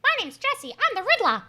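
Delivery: high put-on villain voice; the Riddler. Co-host claiming to be a supervillain, high Riddler impression. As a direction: introduce yourself in a squeaky high character voice. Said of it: "That's a perfect Jim Carrey impression".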